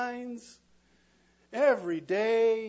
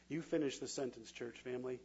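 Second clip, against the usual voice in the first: normal talking voice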